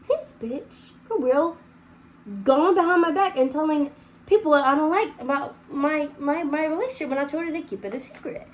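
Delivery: a mild retold fury at a friend; indignant rant. Angry at friend's betrayal.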